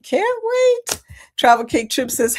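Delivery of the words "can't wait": much higher, squealed with excitement; excited squeal. Real, not acted. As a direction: squeak it out, too eager to hold back. Excited anticipation of cruise.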